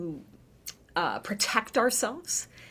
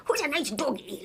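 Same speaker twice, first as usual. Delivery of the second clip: soft, higher doggy-talk voice; talking to her dog; 5 semitones higher, 5 dB quieter, faster than usual. Retold or imitated. She baby-talks to her dog, softer and higher. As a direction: coo it like praising a puppy. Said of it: "a certain way of talking to my dog".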